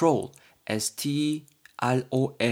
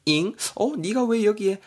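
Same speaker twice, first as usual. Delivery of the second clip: mock surprise; 9 semitones higher, slower than usual. Surprised meeting acted.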